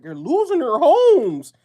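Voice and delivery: hammed-up high wail about losing homes; mock panicked Doomer. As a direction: whine it like a panicking doomsayer.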